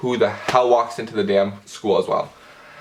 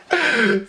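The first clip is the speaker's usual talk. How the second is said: high burst of real laughter; 12 semitones higher than usual. Real, not acted. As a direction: crack up at your own line. Laughing at her own insult.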